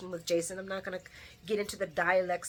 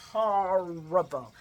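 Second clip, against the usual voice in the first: slow, stretched 'Horrible'; drawn-out disgust; 3 semitones lower, much slower than usual. Real, not acted. Condemning how they trash Drew, slowly drawn out. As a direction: draw out the first syllable of 'Horrible'. Repeats word drawn out for emphasis.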